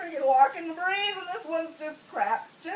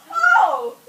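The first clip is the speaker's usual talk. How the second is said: high, loud cry of release; sudden mock birth cry, high; 13 semitones higher, 13 dB louder than usual. Woman as baby comes out, loud cry.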